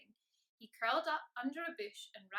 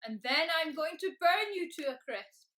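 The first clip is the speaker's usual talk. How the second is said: loud, raised roar of threat; dragon bellow; 6 semitones higher, 10 dB louder than usual. A narrator's reading. Dragon threatens to burn Mister Tickle, bellowing louder and higher. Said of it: "bellowed the dragon".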